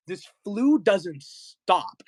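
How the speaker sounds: slow, drawn-out mock despair; dramatic lament. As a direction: groan it out theatrically. Dramatic complaint about flu.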